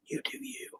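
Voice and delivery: breathy whispered blessing to the paint. Acted. Whispering to the painting, you do you. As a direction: hush it like a secret to the paint.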